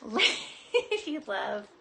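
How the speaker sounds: slow words through a laugh. Real, not acted. Laughing at love reading.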